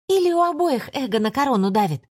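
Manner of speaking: mock their pride; mocking spirit voice. Shadow keeps scolding.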